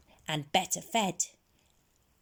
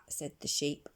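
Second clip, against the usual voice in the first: usual speaking voice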